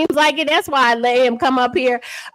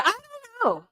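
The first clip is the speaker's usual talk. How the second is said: much higher, softer, sung-out mock; mock high singsong; 9 semitones higher, 8 dB quieter than usual. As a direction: toss off "I don't know" way up high, softly. Mock repeat, high then plain.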